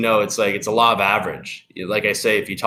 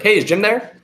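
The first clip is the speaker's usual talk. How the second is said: high, familiar phone greeting; casual familiarity; 11 semitones higher, 4 dB louder than usual. Shown as a demonstration. Caller asking secretary for a friend, breezy and familiar. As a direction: ask as if he's an old friend. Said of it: "like you know Jim".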